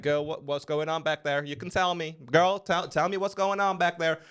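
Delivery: high, sassy lift attendant voice; imitating lift attendant. Lift attendant asking the girl what happened, high and sassy. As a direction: coax it up high like a nosy attendant. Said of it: "she's like".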